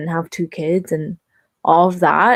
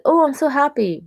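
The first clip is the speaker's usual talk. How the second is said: higher, bright gushing quote; mock happy gush; 8 semitones higher than usual. Recalling the surprise gift from a past friend, gushing. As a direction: play the instant delight at the gift. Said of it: "I was like".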